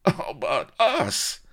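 slow, high, loud protest; yelled. A narrator's reading. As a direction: cry out over the executioner's complaint. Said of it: "the soldiers yelled".

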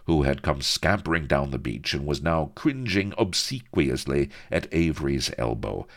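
ordinary delivery, nothing marked

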